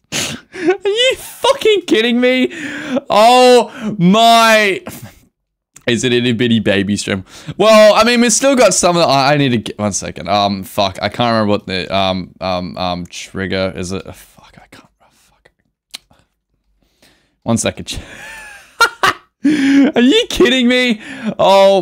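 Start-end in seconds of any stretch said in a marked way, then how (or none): 0.1-4.8 high, slow outraged wail; outraged disbelief. Real, not acted. Streamer losing in game, slow high outraged wail. 14.0-15.5 whispered panicked curse. Real, not acted. Streamer muttering in panic, whispered cursing. 19.4-21.8 high, slow wailing disbelief. Real, not acted. Streamer losing again, slow high wail. Another loss in game.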